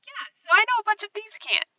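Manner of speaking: high-pitched, put-on character line; player's character voice. Rogue telling the others she knows thieves' cant, high character voice.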